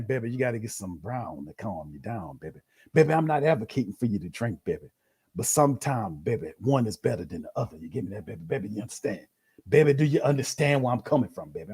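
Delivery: put-on low, soothing preacher voice calling everyone baby; Reverend Saint impression. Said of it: "Reverend Saint saying".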